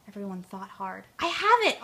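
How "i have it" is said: high, loud eureka shout; excited cry. Acted. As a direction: burst out with the sudden idea. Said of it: "Ollie cried".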